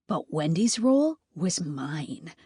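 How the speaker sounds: ordinary delivery, nothing marked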